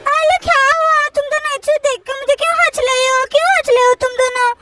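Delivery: put-on high, lisping child voice; babyish, puzzled complaint; little girl Chutki, put out. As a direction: protest like a small kid being laughed at. Chutki asks why they laugh.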